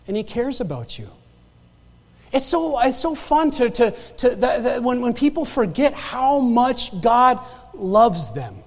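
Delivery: usual speaking voice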